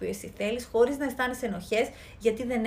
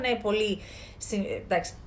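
usual speaking voice

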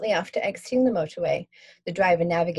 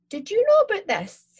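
high-pitched accusing mum; mum's voice; 5 dB louder than usual. Acted. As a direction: pin the daughter down like a suspicious mum. Mum voiced, suspicious question.

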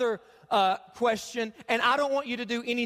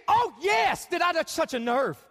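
excited shout; 4 semitones higher, 3 dB louder than usual. Real, not acted. Pastor cheering the congregation's reaction, excited shout. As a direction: pitch up, louder, thrilled at the crowd.

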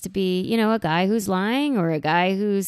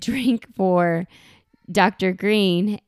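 ordinary delivery

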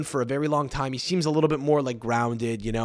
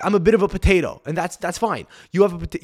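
ordinary talk, nothing marked

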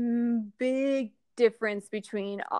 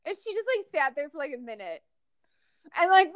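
ordinary talk

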